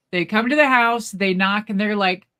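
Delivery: ordinary delivery, nothing marked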